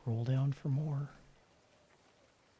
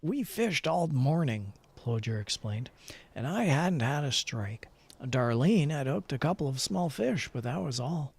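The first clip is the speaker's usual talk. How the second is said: a slightly louder storyteller read; story narration; 5 dB louder than usual. Acted. Player reading the in-game fishing story aloud in a storyteller voice. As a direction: read it like a true-adventure tale.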